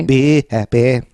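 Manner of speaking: put-on singer impression. Co-host voicing alien as singer, put-on accent. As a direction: finish in the famous singer's accented voice. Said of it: "had [name]'s voice".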